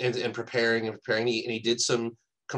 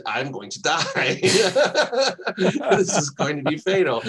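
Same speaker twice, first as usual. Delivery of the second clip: laughing through a retold quote; giggling while quoting; 6 semitones higher, 6 dB louder than usual. Retelling a terrified quote, laughing.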